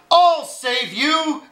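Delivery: high, slow rescue call; lightly dramatized promise to rescue; heroic cry. A narrator's reading. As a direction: call out as a mild storybook hero. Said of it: "cried Arthur".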